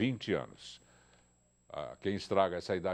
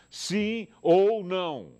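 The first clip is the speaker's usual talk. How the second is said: rallying call; 7 semitones higher, 7 dB louder, much slower than usual. Real, not acted. Host rallies crew for answer.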